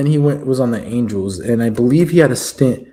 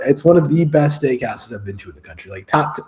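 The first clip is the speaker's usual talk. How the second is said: ordinary talk, nothing marked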